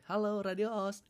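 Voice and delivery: high, distant caller on the phone line; a listener cheerfully naming the wrong station; caller's voice. Retold or imitated. Caller mistakenly greeting rival radio, high and cheerful.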